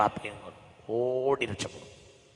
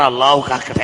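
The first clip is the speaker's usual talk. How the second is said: louder blessing called out; called-out prayer line; 10 dB louder than usual. Shown as a demonstration. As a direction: lift the voice so all repeat. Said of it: "ഉറക്കെ പറ".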